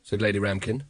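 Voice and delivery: plain reading voice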